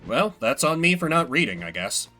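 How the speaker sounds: normal talking voice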